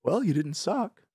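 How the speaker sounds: high, slow backhanded compliment; lightly mock the friend's jab; backhanded praise. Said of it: "his running joke ... is he goes".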